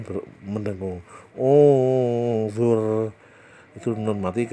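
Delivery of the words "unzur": "Unzur" recited louder, the n blurred into a hum before the z; Quran recitation. Shown as a demonstration. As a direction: chant "Unzur" with a hidden nasal. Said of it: "Nunnya tidak begitu jelas". (lantunkan "Unzur" dengan nun samar berdengung)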